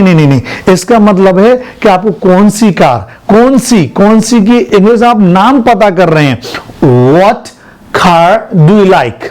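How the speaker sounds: ordinary talk, nothing marked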